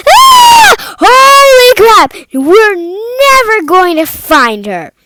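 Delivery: loud frightened shriek; panicked scream. Acted. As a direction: shriek it out, terrified for her missing friend. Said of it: "she starts screaming".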